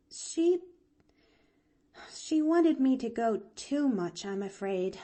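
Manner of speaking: put-on high, hesitant girl voice; sighing. A narrator's reading. Girl explaining her aunt to the doctor, sighing. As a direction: confess it haltingly with regret. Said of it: "sighed Pollyanna".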